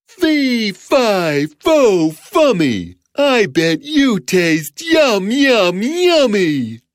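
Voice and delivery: high loud giant, slow and gloating; hungry giant. Acted. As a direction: savor the meal ahead. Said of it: "said the giant".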